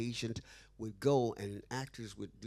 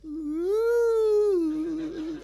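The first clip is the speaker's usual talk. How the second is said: high, loud falsetto ooh; a loud falsetto ooh of surprise; impressed squeal; 15 dB louder than usual. Retold or imitated. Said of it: "Like that".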